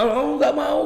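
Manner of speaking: a hint of childish protest; refusing child. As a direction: lightly play a kid balking. Acts out a child refusing anaesthetic.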